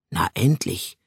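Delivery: low grumble from Rio; grumbling. A narrator's reading. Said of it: "brummte Rio".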